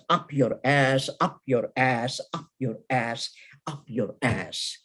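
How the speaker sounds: low, softened poetic repetition; fading defiant refrain. Acted. Poem refrain sinking after outburst.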